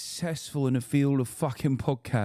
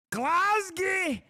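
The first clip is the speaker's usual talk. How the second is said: Glasgow said very high in mock Scottish; hammed-up Scottish Glasgow; Scottish accent. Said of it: "Say Glasgow".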